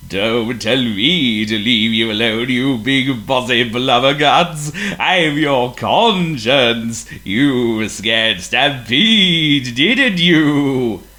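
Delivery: slow, loud, booming ghostly voice; eerie ghost voice. A narrator's reading. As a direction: boom like a spooky conscience. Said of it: "the eerie voice again".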